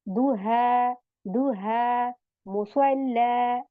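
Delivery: "दुहा" and "मुसल्ला" ending on held long aa; madd ending. Shown as a demonstration. Teacher showing how madd is made, long final aa in "दुहा", "मुसल्ला". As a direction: recite slowly, stretching the last vowel of "दुहा", "मुसल्ला". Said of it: "इस तरीके से हम इसको मद".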